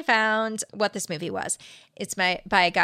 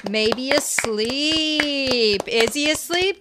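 usual speaking voice

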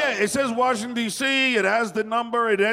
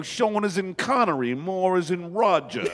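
higher, drawn-out fake English accent; bad English accent; 4 semitones higher than usual. Prank caller explaining his fake name, bad English accent. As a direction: ham up a poor English accent, stretching the words. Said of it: "That is a bad accent".

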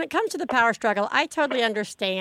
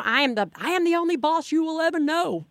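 mock-tyrant proclamation, higher pitched; domineering parent; 5 semitones higher than usual. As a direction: lay down the law with hammed-up authority. Parent acts out power struggle.

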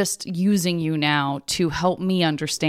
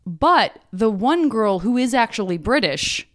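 normal talking voice

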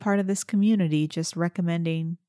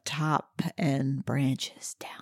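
storybook voice; 4 semitones lower, much slower than usual. Pretend. Playful made-up fantasy description.

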